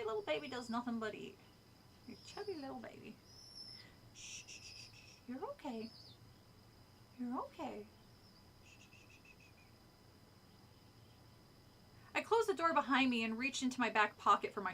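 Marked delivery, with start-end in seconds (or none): 4.1-10.6 soft whispered shushing; soothing shush. Real, not acted. Owner calming her frightened pet with soft shushes. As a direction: hush the little pet gently.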